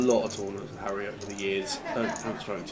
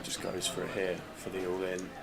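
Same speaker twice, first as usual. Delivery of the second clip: hushed poker commentary; 5 dB quieter than usual. Acted. Quiet play-by-play commentary.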